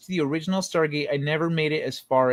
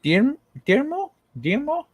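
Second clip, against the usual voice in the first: higher questioning rise on termo; puzzled repeat; 11 semitones higher than usual. Real, not acted. Streamer echoing the Russian word back, baffled. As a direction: try the strange word out, confused.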